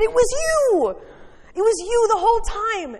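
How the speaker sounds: hammed-up furious yell at a caterpillar; outraged yell. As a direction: re-enact yelling at the culprit you just caught. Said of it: "I think I actually yelled at the".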